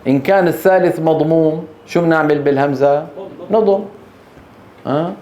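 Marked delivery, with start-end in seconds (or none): none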